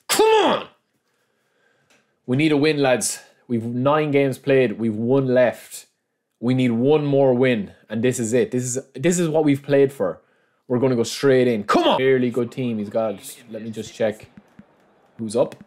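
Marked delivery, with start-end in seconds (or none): 0.1-0.7 high, explosive rallying yell; psyched-up shout. Real, not acted. Psyching up before last match. 11.6-12.0 sudden high rallying yell; psyched-up shout. Real, not acted. Psyching up mid sentence.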